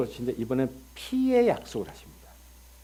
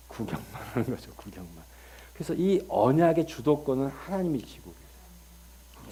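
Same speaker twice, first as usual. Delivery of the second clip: usual speaking voice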